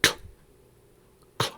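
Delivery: "k" as a bare click-like release, no vowel; isolated consonant. Shown as a demonstration. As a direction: make just the consonant "k", separately. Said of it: "sound is different than".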